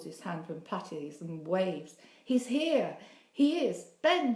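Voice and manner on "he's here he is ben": shouted high and loud, rising each time; a boy yelling gleefully to his friend across the playground; excited shout. Acted. Boy spots his friend arriving late, excited high shout. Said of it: "he shouts".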